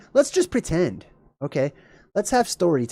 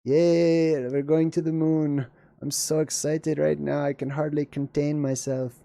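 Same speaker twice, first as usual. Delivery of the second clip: flat, soft sarcastic yay; deadpan mock excitement; 5 dB quieter than usual. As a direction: fake thrill fading to a murmur. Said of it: "Kinda, right".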